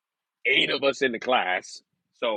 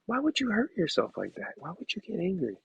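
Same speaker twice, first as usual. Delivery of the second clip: scolding myself; 8 semitones higher, 6 dB quieter than usual. Retold or imitated. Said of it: "I'm like".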